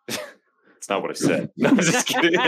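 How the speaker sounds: laughing through the words. Real, not acted. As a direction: giggle while taking it back. Laughing denial.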